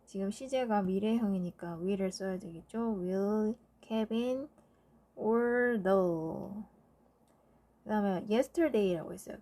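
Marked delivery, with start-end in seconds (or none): 3.0-6.5 slow dictation. Shown as a demonstration. Teacher dictating answer slowly.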